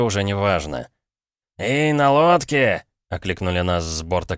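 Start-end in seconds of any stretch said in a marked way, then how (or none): 1.6-2.8 loud, slow hail across water; calling out. A narrator's reading. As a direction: shout to the boat. Hailing a boat from deck.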